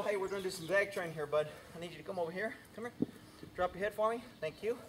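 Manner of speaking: quiet, gentle coaxing to the horse; calm him down softly; soft horse talk. Shown as a demonstration.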